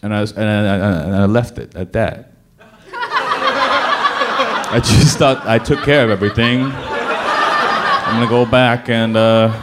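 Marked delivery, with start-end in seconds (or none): 2.9-4.7 laughing. Real, not acted. Laughing at own story. 4.7-9.5 laughing. Real, not acted. Laughing while telling.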